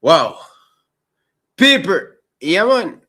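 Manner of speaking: loud, high excited exclamation; excited outburst. Real, not acted. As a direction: burst out with excitement. Host reacting to caller.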